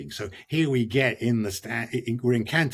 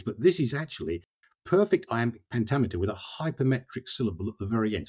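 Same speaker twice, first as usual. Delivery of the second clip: ordinary delivery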